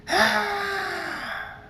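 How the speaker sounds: long, very high-pitched scream. A narrator's reading. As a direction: shriek high and hold it. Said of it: "and screams".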